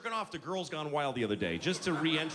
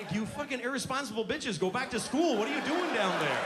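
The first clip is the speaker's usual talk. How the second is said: higher, scolding yell at the screen; mock angry rant; 8 semitones higher, 4 dB louder than usual. Grumpy father yelling at girls in the video, mock angry. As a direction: bark at them like a furious dad. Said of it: "I'm like".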